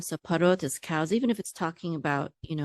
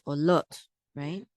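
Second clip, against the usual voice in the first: ordinary delivery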